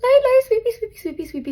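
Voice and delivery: high, cutesy little chant; tiny playful voice that starts high and lightens; playful sweepy voice. Pretend. Showing viewers light powder sweeps, playful high voice.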